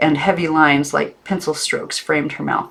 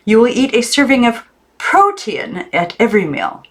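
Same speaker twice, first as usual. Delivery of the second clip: high, loud dictating aunt; stern aunt; 7 semitones higher, 4 dB louder than usual. A narrator's reading. Aunt Lillian at the table listing rules, high and stern. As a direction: issue the diet rules firmly. Aunt voiced in read story.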